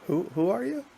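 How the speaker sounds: lightly mimicking a puzzled streamer; feigned confusion. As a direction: play dumb, asking who this is. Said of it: "he pretend to not know who they are".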